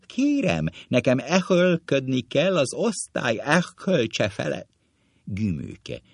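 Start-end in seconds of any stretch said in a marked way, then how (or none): 0.0-4.7 high, screechy old schoolmaster impression; sharp rasping teacher voice; thin, sharp, crackling teacher. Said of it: "éles és recsegő hangon".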